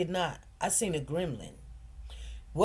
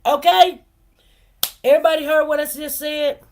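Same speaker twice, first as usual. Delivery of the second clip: loud, higher, outraged; indignant shout; 4 semitones higher, 9 dB louder than usual. Real, not acted. Mother telling listeners of her ex's laughter, loud and indignant. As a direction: call it out to the whole room.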